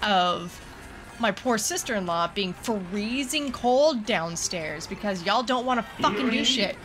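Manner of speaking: normal talking voice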